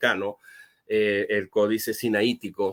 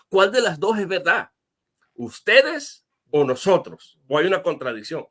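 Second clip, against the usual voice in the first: higher, challenging skeptic's voice; mocking skeptic; 5 semitones higher, 3 dB louder than usual. Skeptic challenging which Bible variant is true, mock-challenging. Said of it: "Van a decir los escépticos".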